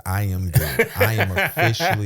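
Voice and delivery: quoting a friend through laughter; laughing quote. Retold or imitated. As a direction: laugh while retelling his refusal. Quoting friend while laughing along.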